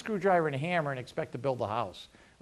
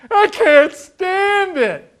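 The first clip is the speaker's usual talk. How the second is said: exasperated outburst; 13 dB louder, much slower than usual. Retold or imitated. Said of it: "He goes".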